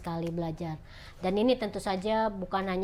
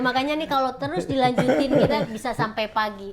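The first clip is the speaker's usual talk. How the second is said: laughing; 5 semitones higher, 6 dB louder than usual. Real, not acted. Joking the chat runs long.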